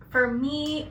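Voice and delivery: normal talking voice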